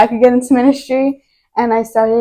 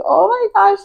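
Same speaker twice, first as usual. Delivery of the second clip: overwhelmed gasp; 10 semitones higher than usual. Retold or imitated. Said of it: "I was just like".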